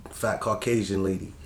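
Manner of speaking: ordinary delivery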